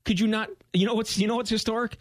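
high-pitched, laughing disbelief; mocking retort. Host answering a tweeter who doubted him, mock indignant. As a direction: throw it back incredulously, pitched well up.

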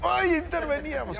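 hammed-up aghast onlookers; mock dismay. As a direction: wail it in exaggerated, high-pitched alarm. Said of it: "O sea, todos así de".

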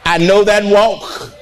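normal talking voice

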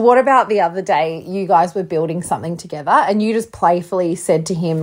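ordinary delivery

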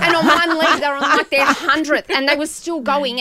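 loud, high, laughing through words. Real, not acted. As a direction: tell it while cracking up.

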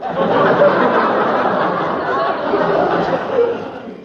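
giggling; a long high giggle; child's laugh. Acted. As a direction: giggle at the joke. Child laughs after quoting.

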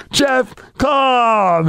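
high, drawn-out name announcement; ring announcer. Retold or imitated. Announcer finishing the wrestler's introduction, big announcer call. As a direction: stretch the name out for the arena.

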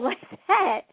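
ordinary talk

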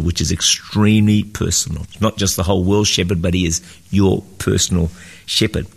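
normal talking voice

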